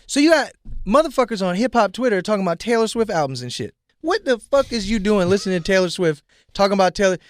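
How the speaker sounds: fired up, pushing every line; venting at fake fans, loud and fast-paced; ranting. Real, not acted. Heated rant about fans.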